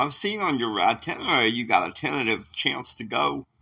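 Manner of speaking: ordinary delivery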